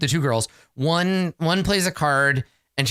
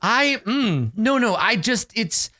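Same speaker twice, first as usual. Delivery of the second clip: much higher, tumbling panicked denials; flustered mother protesting; 9 semitones higher, slower than usual. Acted. Mother refusing to let the ogre guest leave, high and panicky. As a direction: scramble to take it back without looking up.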